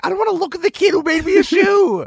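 high-pitched pleading protest; hammed-up high wail of objection; mock protest. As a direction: act out a squeamish refusal, voice shooting up high. Acts out imagined horrified reaction to gift.

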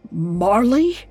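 a touch of shaky disbelief; mild, stunned muttered question. Acted. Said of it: "Scrooge's voice shook in disbelief as he muttered".

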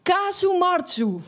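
mock chef calling out Kasumartsu with glee; called out name. Chef laughing at the narrator, calling out Kasumartsu. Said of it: "En hij riep".

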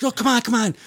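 high mock hurry-up; officer urging. Officer hurries the stuck rookie, high and mock.

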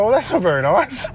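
retold hurt, played comically; mock-hurt protest. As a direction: act mildly offended, for laughs. Retold mock-hurt reply to insult.

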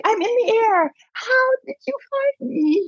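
high-pitched, incredulous protest; exasperated disbelief. Retold or imitated. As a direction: plead in disbelief, voice shooting way up. Protesting being found mid-flight.

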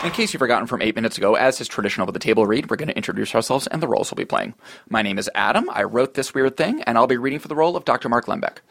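ordinary talk

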